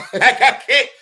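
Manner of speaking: high, loud, laughing through the words; laughing mock dismay. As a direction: imitate an appalled recruit while cracking up. Acts recruit's dismay at visiting Iowa.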